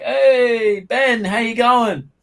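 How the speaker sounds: high, drawn-out mock greeting; imitated friendly greeting. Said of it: "all the fucking Africans are like".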